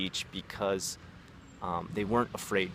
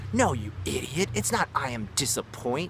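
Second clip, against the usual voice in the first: higher, mocking put-on scold; super condescending; 6 semitones higher than usual. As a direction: belittle a student in an exaggerated voice. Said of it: "be super condescending".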